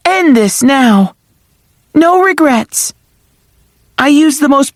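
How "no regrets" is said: ordinary delivery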